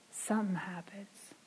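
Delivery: hushed, breathy whisper for effect; a whispered hook mid-sermon. Real, not acted. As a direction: drop to a whisper to draw them in. Preacher drops to hushed aside.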